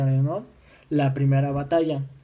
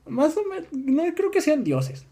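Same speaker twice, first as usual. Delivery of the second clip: mock doubting crowd; 13 semitones higher than usual. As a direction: imitate doubters in a high, stretched voice. (voz aguda fingida de gente que duda)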